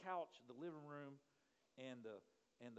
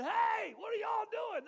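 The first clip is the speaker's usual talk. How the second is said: high startled shout; loud high alarmed shout; 13 dB louder, slower than usual. Retold or imitated. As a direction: yell out in a panic at the intruders. Startled, calling out in dark.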